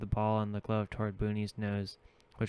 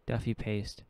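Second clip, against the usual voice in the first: ordinary delivery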